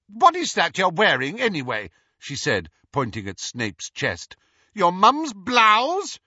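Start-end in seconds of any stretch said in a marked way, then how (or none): 0.1-1.9 high, loud mocking jeer; sneering taunt. Petunia mocks Snape's clothes to hurt him, high and loud. 4.7-6.2 high, loud, slow sneering voice; mocking jeer. Petunia jeers at Snape's clothes, high, loud and slow.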